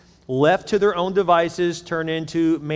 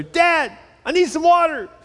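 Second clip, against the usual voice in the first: put-on high, loud little boy calling down the hall; kid calling out; 12 semitones higher, 4 dB louder than usual. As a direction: whine for water from bed. Child calling from bedroom.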